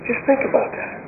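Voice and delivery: hushed, breathy whisper to the congregation. Real, not acted. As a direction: lean in and whisper it for weight. Preacher drops to a whisper for effect.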